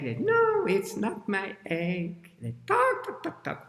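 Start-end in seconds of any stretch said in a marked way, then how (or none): 0.2-2.3 high opening, then slow sing-song denial; answering animal. Shown as a demonstration. Teacher demonstrates the repeated answer, high sing-song denial. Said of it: "we still have our sentence pattern". 2.7-3.7 loud high squawk dropping into quick clucks; clucking hen. Shown as a demonstration. Miss Hen calls out over her eggs, squawking and clucking. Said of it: "we still have our sentence pattern".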